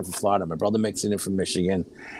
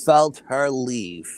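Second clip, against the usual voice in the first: slow, heavily spaced words; solemn testimony; much slower than usual. Real, not acted. Child swearing they felt Mom's soul leave, slow and emphatic. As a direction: draw out each word with conviction.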